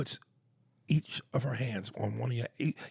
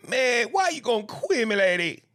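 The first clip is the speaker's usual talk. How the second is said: [name] impression; 9 semitones higher, 5 dB louder than usual. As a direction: mimic [name]'s ad-lib delivery. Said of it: "[name], he said that shit".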